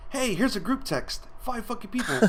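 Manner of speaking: high, exasperated rant; mock irritated ranting; annoyed. Retold annoyance at group texts.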